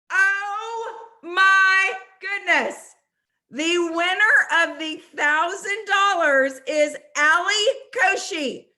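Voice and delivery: slow, high, loud sung-out announcement; excited announcement. Real, not acted. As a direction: draw out the big reveal. Announcing the big winner.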